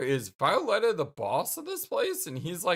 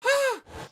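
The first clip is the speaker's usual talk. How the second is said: high sharp gasp; a gasp with a falling end; acted gasp; 4 dB louder than usual. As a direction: suck in air as if startled. Reacting to a creepy scene.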